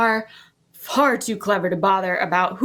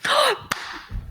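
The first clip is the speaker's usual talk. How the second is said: breathy gasp; 3 dB quieter than usual. Acted. Shocked reaction in scene.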